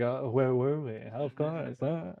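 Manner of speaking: soft, hesitant emo satyr voice. Acted. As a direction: murmur it like an emo lute player. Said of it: "the emo satyr's just like".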